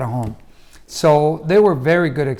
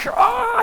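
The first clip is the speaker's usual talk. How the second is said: high-pitched imitated scream of pain; drawn-out pained "oh", pitched way up; hammed-up wail without extra volume; mock scream. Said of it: "and I'm screaming".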